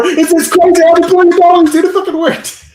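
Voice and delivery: high, loud excited yelp; giddy friend. Retold or imitated. Ian bursting in to tell Bear it worked, high giddy yelp. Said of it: "He's knocking on the door".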